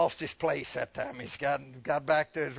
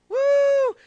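long, high, loud "woo"; a full-voiced whoop held out; excited whoop; 12 dB louder than usual. Real, not acted. Preacher picturing the rapture, whooping.

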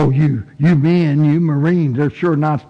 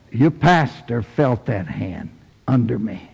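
ordinary talk, nothing marked

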